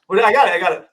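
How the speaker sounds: ordinary talk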